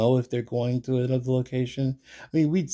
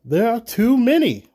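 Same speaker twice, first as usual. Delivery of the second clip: loud, high-pitched protest; 12 semitones higher, 9 dB louder than usual. A narrator's reading. Reginald objecting to attacking the band, protesting. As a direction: object forcefully, voice jumping up. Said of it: "Reginald protests".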